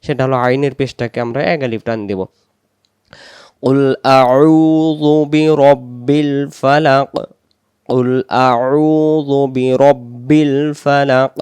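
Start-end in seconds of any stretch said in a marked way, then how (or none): 3.6-11.3 slow, elongated recitation of an Arabic verse; Quran recitation. Shown as a demonstration. Teacher reciting the first verse for learners, slow recitation. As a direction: stretch the long vowels, measured and full-voiced.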